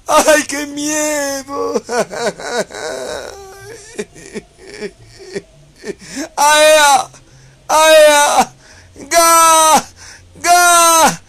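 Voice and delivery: high, loud, hammed-up crying shrieks; over-the-top fake sobbing squeals; mock frightened wailing. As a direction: wail like a scared crybaby to mock them. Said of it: "Eso deben gritar".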